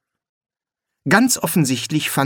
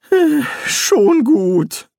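sighing; 13 semitones higher, 4 dB louder, much slower than usual. A narrator's reading. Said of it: "seufzte Rincewind".

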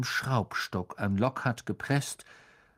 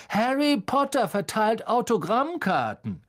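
high, mocking announcement to a crowd; taunting, carrying call; booming call; 10 semitones higher than usual. A narrator's reading. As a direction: announce it like a jeering crier. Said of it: "dröhnte Malfoy in die Menge hinein".

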